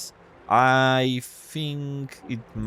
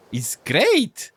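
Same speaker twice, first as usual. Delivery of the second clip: lightly voiced high crab; squeaky crab voice; 7 semitones higher, 4 dB louder than usual. Crab praising crab life, lightly squeaky voice. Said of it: "a squeaky voice".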